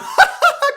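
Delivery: squeaky burst of laughter; short high laugh. Real, not acted. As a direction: crack up at yourself. Laughing at his cheeky offer.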